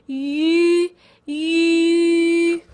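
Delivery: long yu squeezed out with the tongue at the front. Shown as a demonstration. Teacher showing learners tongue position, squeezed level yu. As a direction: say ee through rounded lips for a held yu. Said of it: "squeeze the e sound out".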